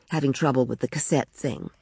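ordinary delivery, nothing marked

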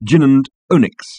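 "gin and onyx" with the t dropped before the last word; phonetic variation. Shown as a demonstration. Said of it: "variations on the same phonetic".

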